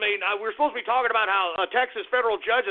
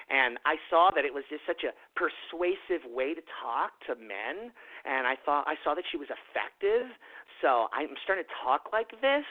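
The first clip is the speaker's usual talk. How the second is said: much lower, softer mock-soft voice; mocked effeminate voice; 8 semitones lower, 6 dB quieter than usual. Host mocks male YouTubers talking like their mothers, soft mimicry. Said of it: "don't always have to talk like".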